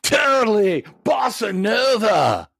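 high, slow, shouted exclamation; excited shout. Pretend. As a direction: call it out with glee. Joining the surfer bit.

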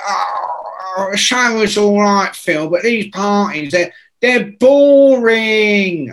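whingeing punk with drawn-out words; mock complaining drawl. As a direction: imitate a bored punk, stretching the last word high. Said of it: "And, and [name] goes".

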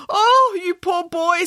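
high, gushing pity; very high, swelling storybook commiseration; sympathising. A narrator's reading. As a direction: wail with overdone sympathy. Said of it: "sympathised Boris".

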